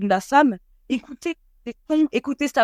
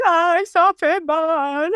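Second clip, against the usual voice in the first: higher, slow, drawn-out "ça fait mal" in pain; pained complaint; 6 semitones higher, 3 dB louder, much slower than usual. Retold or imitated. Retelling the birth to a friend, mock pained moan. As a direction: stretch out the complaint like a contraction hits. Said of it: "C'est la seule fois où je me suis plainte".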